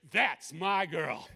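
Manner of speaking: loud, slow fatherly pride; proud exultation. Real, not acted. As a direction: burst out with pride, drawing it out. Proud father exults over daughter.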